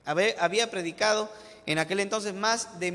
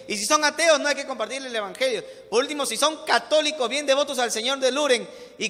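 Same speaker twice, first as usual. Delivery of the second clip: louder, higher, driving sermon voice; fervent preaching; 4 semitones higher, 5 dB louder than usual. Real, not acted. Pastor rebuking the church's prejudice, preaching at full force. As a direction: preach it hard to the crowd.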